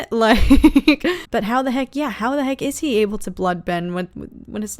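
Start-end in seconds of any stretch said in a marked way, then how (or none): 0.0-1.0 laughing. Real, not acted.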